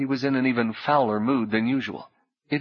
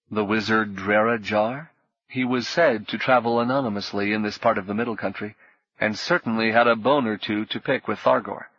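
ordinary delivery, nothing marked

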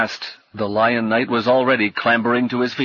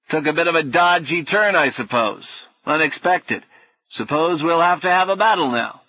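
higher-pitched, scatterbrained knight; 6 semitones higher than usual. A narrator's reading. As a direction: muse aloud as a vague old-fashioned knight.

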